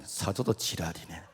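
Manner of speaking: low, slow, blunt Gyeongsang grumble; gruff Gyeongsang husband. Acted. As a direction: mutter it like a tactless Gyeongsang man. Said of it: "경상남이". (경상도 남편의 낮고 느린 퉁명스러운 말투)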